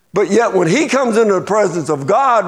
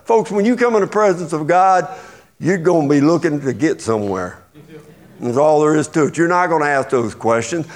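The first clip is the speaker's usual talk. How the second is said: normal talking voice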